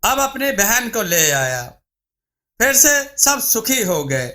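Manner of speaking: ordinary delivery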